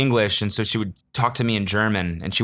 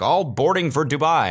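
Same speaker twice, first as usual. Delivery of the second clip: airport announcement; 7 semitones higher than usual. Retold or imitated. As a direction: announce it over the PA. Imitating terminal message.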